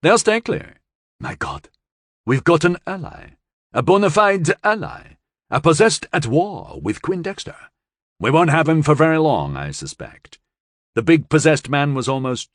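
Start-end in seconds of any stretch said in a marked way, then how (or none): 0.0-0.8 higher, louder, astonished excitement; thrilled at finding an ally; excited Western Europe. Acted. 1.2-1.7 whispered in awe; a stunned hushed aside; breathless whisper. Acted. Astonished aside. 2.2-10.4 higher, louder, astonished excitement; thrilled at finding an ally; excited Western Europe. Acted.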